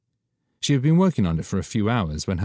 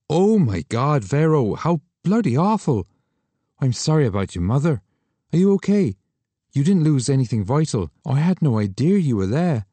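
higher, louder shocked sympathy; Douglas horrified; 7 semitones higher, 5 dB louder than usual. Acted. Friend learning of the bombing, higher horrified voice. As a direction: react with horror and care.

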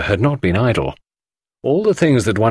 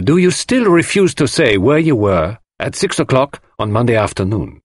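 higher-pitched, emphatic questioning; Poirot, insistent interrogator; 5 semitones higher than usual. Acted. Poirot demands the suspect's whereabouts, insistently. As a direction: press the suspect for an answer.

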